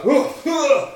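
two high, loud gasps; hammed-up waking grunts; mock groggy gasps. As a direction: gasp as if coming round from a knockout. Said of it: "he's knocked out and he's like".

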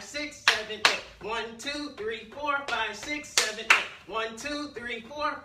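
loud rhythmic count while jumping; shouted counting. Real, not acted. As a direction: call each count out hard.

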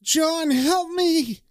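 high, very slow, drawn-out cry for help; desperate yell. Retold or imitated. Pinned-down Ben begging John for help, high stretched wail. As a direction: wail John's name like you're pinned down. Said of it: "Ben sees him and yells".

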